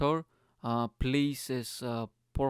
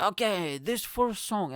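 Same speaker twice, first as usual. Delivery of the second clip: slightly hyped, higher-pitched singer; stage announcement; 7 semitones higher, slower than usual. Retold or imitated. Singer introducing first song, lightly imitated stage call. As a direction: announce it like a frontman.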